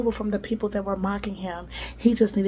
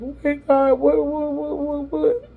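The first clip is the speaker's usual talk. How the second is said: loud, high strained repeated what; high-pitched stalling; 7 semitones higher, 5 dB louder than usual. Acted. Procrastinator sitting and asking God, high-pitched stalling. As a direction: pitch it up and climb higher on the last what.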